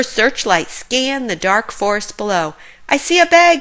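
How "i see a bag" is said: excited report. A narrator's reading. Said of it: "she reported".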